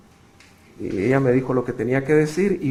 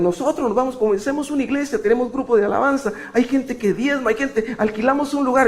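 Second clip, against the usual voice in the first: much higher pitch, a rush of eager persuasion; eager urging; 12 semitones higher than usual. Retold or imitated. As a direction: tempt him like a crowd of excited followers. Leaders urge him on the phone.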